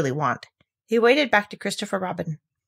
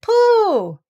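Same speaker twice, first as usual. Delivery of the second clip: high, louder delighted shout; joyful cry; 8 dB louder than usual. A narrator's reading. Christopher Robin finding Pooh again, high joyful cry. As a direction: burst with relief.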